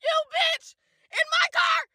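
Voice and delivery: high, furious yell with a cry; outraged shout. Real, not acted. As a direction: scream in disbelief. Outraged at his question.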